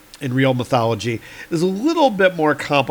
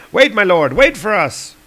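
higher, louder call; calling after; 7 semitones higher, 5 dB louder than usual. Acted. Warriors Three calling to departing Thor, higher and louder. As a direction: call out to him as he leaves.

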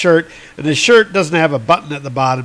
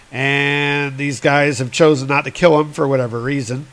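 normal talking voice

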